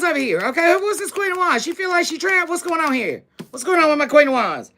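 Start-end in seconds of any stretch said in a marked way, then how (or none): none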